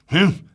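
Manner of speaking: loud grumpy humph; grunt. Acted. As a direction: grunt your disdain. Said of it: "grunted Mister Grumpy".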